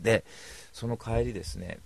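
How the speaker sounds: normal talking voice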